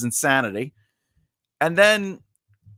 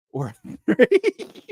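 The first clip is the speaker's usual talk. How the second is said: laughing; 9 semitones higher, slower than usual. Real, not acted. Laughing through the punchline.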